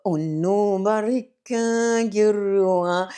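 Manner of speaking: "Barika" stretched out slowly, sounded like Nubian and not English; Nubian words. Shown as a demonstration. Sharing favourite Nubian words with her viewers, said as Nubian. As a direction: say "Oh, no, Barika, Girua" the way Nubian speakers do. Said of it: "And I love the Nubian, like".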